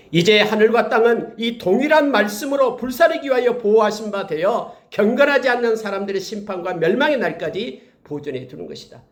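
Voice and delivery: emphatic preacher's declamation; forceful scripture reading. Real, not acted. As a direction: proclaim the verse high and strong.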